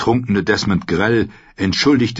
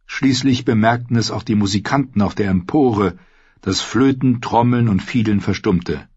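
ordinary delivery, nothing marked

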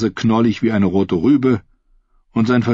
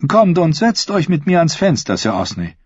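higher-pitched royal lady; inviting queen; 9 semitones higher than usual. Acted. Queen inviting Sir Osney to sit with her, high inviting voice.

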